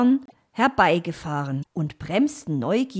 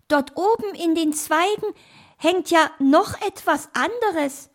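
high storybook animal voice; hare character; 7 semitones higher, 4 dB louder than usual. Acted. Hare spots a letter in the branches, high curious voice. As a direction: play a curious hare, pitched up.

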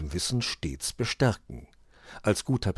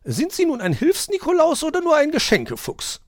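very high, loud teasing magpie jab; sharp retort; 9 dB louder than usual. A narrator's reading. Frau Elster counters the fox, shrill and cheeky. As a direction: snap back with a cheeky question. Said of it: "konterte die Elster".

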